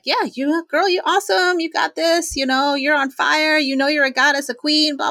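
mock gushing hype from a friend. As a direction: pump her up like a best friend. Friend hyping her up.

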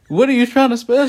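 loud, high-pitched baffled question; incredulous. Retold or imitated. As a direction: demand an explanation in disbelief. Acted baffled reaction to misspelling.

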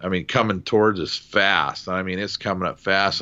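ordinary delivery

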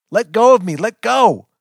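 high, full-voiced struggling shout; furious yell. A narrator's reading. Pinned narrator struggles against the jerks, yelling hard. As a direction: yell to be let loose. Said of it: "I am really yelling now".

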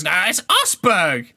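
put-on correcting the other kid. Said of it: "the other one goes".

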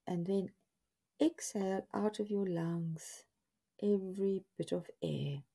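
measured guided-relaxation voice with long pauses; guided body scan. Acted. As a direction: lead them step by step, leaving space after each instruction. Leads listeners through guided body scan.

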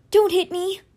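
ordinary delivery

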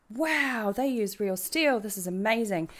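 re-enacting an excited "wow"; amazed. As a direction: recall being bowled over. Quotes own awed first reaction.

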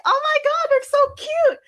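high-pitched gush over cute puppies; acted childlike excitement. Child gushing over new puppies.

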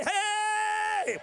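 high loud shout of hey. Real, not acted. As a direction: call it out to rouse everyone. Calls congregation to stand.

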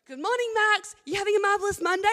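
an over-the-top sunny hello; cheery greeting. Retold or imitated. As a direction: sing out a chirpy good morning to the tutor.

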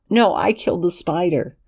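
put-on high, pleading young man's voice; desperate Lexington. Lexington insisting.

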